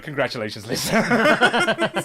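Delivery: mock congratulations breaking into a laugh; laughing judge. Judges hand Lisa the prize, laughing through it. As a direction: announce it while cracking up. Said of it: "they just turn to her and is like".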